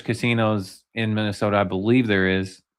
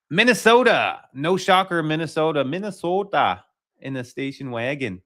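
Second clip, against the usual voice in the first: mock Minnesota accent, pitched up; 5 semitones higher than usual. Host imitating a classmate who moved from Minnesota, Minnesota accent. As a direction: put on a hammed-up Minnesota twang. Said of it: "he would talk just like that".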